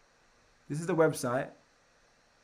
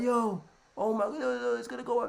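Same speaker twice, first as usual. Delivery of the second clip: higher, excited yo yo; excited phone call; hyped call to friends; 8 semitones higher than usual. Acted. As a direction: hype up your friends. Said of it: "I'm like".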